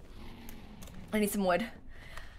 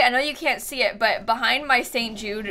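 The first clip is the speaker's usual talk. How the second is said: ordinary talk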